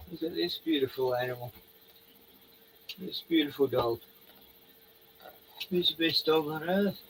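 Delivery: softly, fondly praising the dog; fond dog praise. Real, not acted. Owner admiring his dog, soft and fond.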